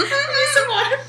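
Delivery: laughing. Real, not acted. Disgusting food, laughing.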